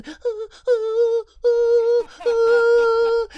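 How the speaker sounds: high, loud wailing ah; mock crying wail. Clerk cries on the floor, a hammed-up high wail. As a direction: keep wailing in terror. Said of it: "all you hear is".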